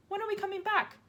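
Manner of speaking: put-on high anxious girl; child voice. A narrator's reading. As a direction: plead with worry. Matilda voiced.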